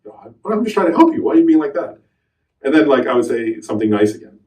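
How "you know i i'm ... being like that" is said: higher-pitched, mildly exasperated protest; retold exasperation, pitched up; a little annoyed. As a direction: plead with mild irritation. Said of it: "getting a little annoyed".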